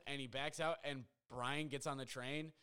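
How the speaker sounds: plain reading voice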